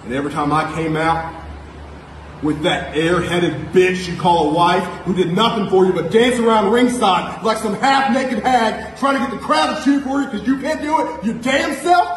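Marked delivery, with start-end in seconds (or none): 2.4-12.1 mocking rising shout. Wrestler taunting rival about his wife, building to a mocking shout. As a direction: pitch climbs higher into a sneering shout.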